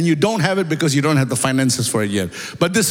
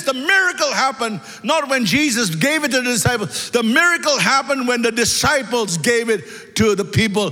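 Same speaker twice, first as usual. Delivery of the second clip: raised, ringing preacher's voice climbing higher; preaching at full force; 5 semitones higher than usual. Acted. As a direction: preach it out to the congregation, building fervour. Preacher builds to a climax.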